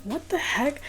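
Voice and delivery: mock a gamer irritated by an interruption; annoyed. Gamer interrupted by a ringing phone beside him, mildly annoyed. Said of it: "So he picks it up annoyed".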